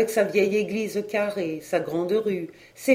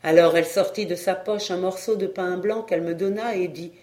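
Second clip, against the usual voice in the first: ordinary delivery, nothing marked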